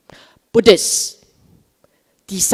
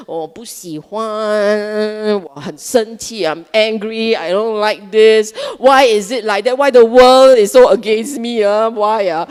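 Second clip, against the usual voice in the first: grumbling mind; 6 dB louder than usual. Acted. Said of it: "Every time your mind talks like that".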